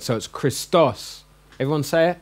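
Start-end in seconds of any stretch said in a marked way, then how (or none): 0.3-1.2 Christos said slowly, landing on -OS; kris-TOS, long accented ending on Christos; stress on last syllable. Shown as a demonstration. Teacher explaining accent marks, Christos stressed on the final syllable. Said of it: "you emphasize that word".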